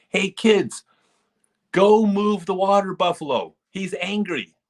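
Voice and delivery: put-on high, loud call to the kids, voice pushed up; mock yell. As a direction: shout the order across the course. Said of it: "that's what he thought he said".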